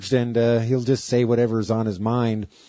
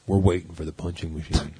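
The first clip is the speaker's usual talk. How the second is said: lower, lightly voiced lug-headed guy; dopey guy voice; 5 semitones lower than usual. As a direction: play it low and a bit dim. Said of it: "So they were kinda".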